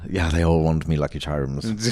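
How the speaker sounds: low Irish leprechaun voice; a touch of Irish leprechaun. Pretend. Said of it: "I go from leprechaun".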